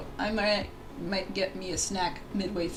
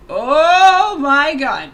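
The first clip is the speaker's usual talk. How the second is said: excited; 5 semitones higher, 14 dB louder, much slower than usual. Real, not acted. Thrilled about particles coming.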